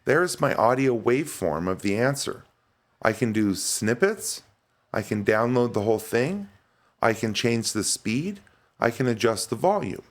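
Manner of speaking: ordinary talk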